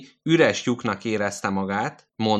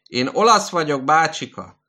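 raised voice; 7 semitones higher, 6 dB louder than usual. Acted. Nurse answers the old man.

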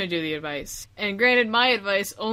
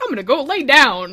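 dopey quote; 4 semitones higher, 9 dB louder than usual. Retold or imitated. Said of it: "and then you were like".

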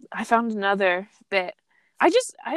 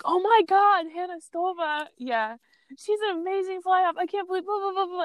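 gushing fan; 9 semitones higher than usual. Pretend. Imagined fan gushing over Hannah.

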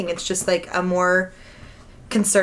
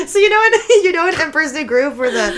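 laughing; 7 semitones higher, 9 dB louder than usual. Real, not acted. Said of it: "what voice is that?".

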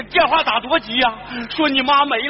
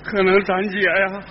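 low, choked-up grieving voice; tearful; 7 semitones lower than usual. Acted. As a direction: speak through tears at a deathbed. Grieving brother at sister's deathbed.

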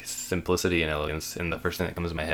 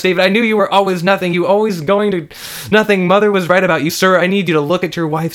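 high, loud, frenzied barrage; shouted commands; 13 semitones higher, 12 dB louder than usual. Acted. Officer and murdered wife berate David together, frantic shouting. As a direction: shout orders and abuse in one breathless rush.

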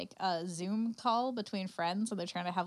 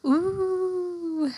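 spooky ooh; 11 semitones higher, 9 dB louder than usual. Acted. Reacting to spooky voice.

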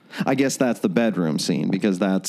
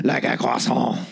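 French chef; 3 semitones higher than usual. Pretend. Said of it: "this episode's accent".

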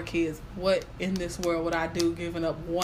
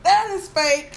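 high, loud denial, much higher; loud disbelief; 11 semitones higher, 7 dB louder, slower than usual. Retold or imitated. To herself over the positive test, loud high denial. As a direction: insist it can't be true.

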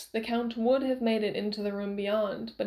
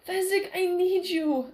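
scream; 9 semitones higher than usual. A narrator's reading. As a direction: scream for the friend's help. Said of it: "Inigo screamed".

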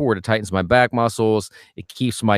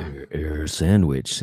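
funny low voice; 8 semitones lower, 4 dB quieter, much slower than usual. Pretend. Savoring the name in a funny voice.

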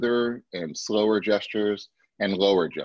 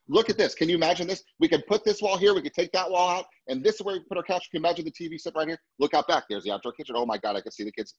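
much higher, bright excited voice rattling off the room ideas; eager visual client; 9 semitones higher than usual. Retold or imitated. Trainer imitating a visual buyer to agents, high and excited. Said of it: "they're using their hands like this because they're phys-, actually trying to paint a picture for you".

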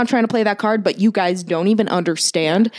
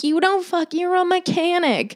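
mock outraged retort; 10 semitones higher than usual. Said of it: "I'm like".

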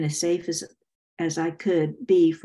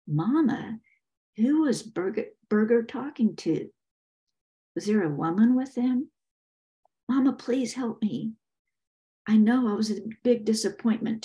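higher-pitched pleading son; frightened, pleading Bugger; 4 semitones higher than usual. Acted. Bugger begging his dead mother for help, pleading. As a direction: plead like a guilty son begging for another chance.

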